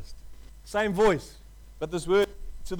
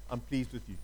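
much softer and lower, almost hushed; hushed, tender; 9 semitones lower, 9 dB quieter than usual. Shown as a demonstration. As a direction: say it softly, lower, like a father close by. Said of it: "God is saying to you today".

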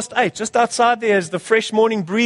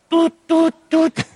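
alarm beeps; 9 semitones higher, slower than usual. Retold or imitated. Preacher mimics his morning alarm, high beeping toots. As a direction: beep like an alarm clock.

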